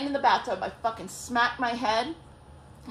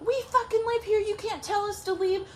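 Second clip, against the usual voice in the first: high, protesting mock sister voice; indignant sister; 10 semitones higher than usual. Sister refusing to leave the house, high indignant protest. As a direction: pitch it up like an outraged sister. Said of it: "ex's sister's like".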